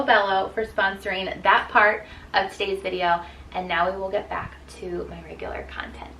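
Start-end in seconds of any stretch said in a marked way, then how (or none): 3.5-6.1 whispering. Acted. Whispered sign-off after the ad.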